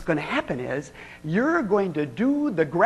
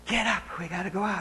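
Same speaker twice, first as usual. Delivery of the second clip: much softer, fast and hushed; urgent whisper; 10 dB quieter, faster than usual. Retold or imitated. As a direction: hurry her along in a hush. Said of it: "He goes".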